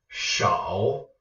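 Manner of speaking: "shao" with a rising end; second tone. Shown as a demonstration. Teacher showing viewers the tone combination, rising second tone. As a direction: let "shao" climb at the end. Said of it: "shao is a second tone".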